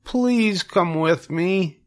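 high, slow, pleading pig voice; begging piglet. Acted. Wilbur begs Charlotte to come to the fair, high slow pleading. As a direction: beg like a scared young pig. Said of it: "begged Wilbur".

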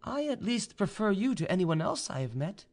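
high feminine character voice; young noblewoman. A narrator's reading. Vaninka admits she prefers him, high-pitched and measured.

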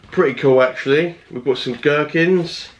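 ordinary talk

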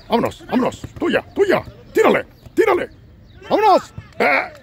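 high, loud sideline yelling; rising cheer, voice jumped way up; frantic urging. Acted. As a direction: push them to shoot. Urging players live.

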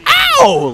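loud, very high cat-like yelp; piercingly high mock yowl; half-man, half-cat yowl. Shown as a demonstration. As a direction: screech an "ow" like a cat. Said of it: "Like if you were a half man, half cat".

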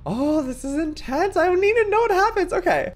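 high, urgent outburst; real high-pitched, worked-up excitement; gripped by suspense. Real, not acted. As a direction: gush out loud, desperate to know.

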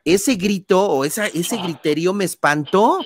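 ordinary delivery

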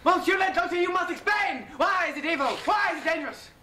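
theatrical French detective, demanding; French accent. Acted. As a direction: press the doctor in a French accent.

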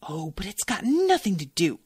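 mildly exasperated objection; irritated interruption. Acted. Shopkeeper interrupts the chat, irritated. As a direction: cut in, a touch irritated. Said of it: "interrupted with a noise of irritation".

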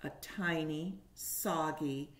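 put-on lively storybook reading for little kids; reading to children. A narrator's reading. Teacher reading a picture book to kindergartners, animated. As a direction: paint each moment of the story for the class.

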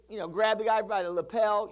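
ordinary talk, nothing marked

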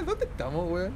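ordinary talk

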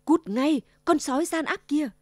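loud angry shout at the wolf; woodcutter's loud angry cry. A narrator's reading. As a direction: yell to chase the wolf off. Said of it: "nghe tiếng thét".

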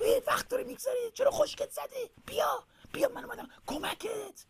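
very high, strained squeaky whisper; high-pitched, hushed urging; urgent little owlet. Acted. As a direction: hurry someone along in a tiny squeaky voice. Little owl urges frozen brother.

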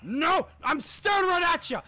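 high, loud shout of protest; frustrated shouting. Real, not acted. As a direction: yell in outrage at the game. Losing to enemy in game.

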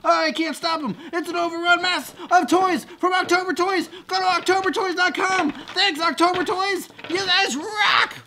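high, loud mock-overwhelmed holler; frantic hyped shouting. Host overrun by toys thanks October Toys, frantic shouting. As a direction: yell as if swamped by toys, building to a big finish.

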